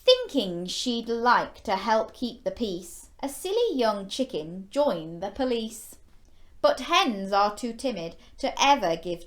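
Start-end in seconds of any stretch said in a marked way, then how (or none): none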